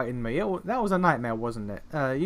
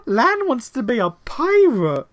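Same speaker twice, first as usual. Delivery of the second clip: higher, louder, slow and giddy; gushing with laughter; 9 semitones higher, 9 dB louder, slower than usual. Real, not acted. Player gushing over Lan's pirate dream, giddy. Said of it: "that's kind of adorable".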